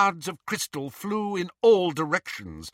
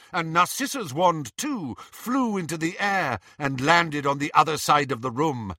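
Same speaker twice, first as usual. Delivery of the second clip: ordinary delivery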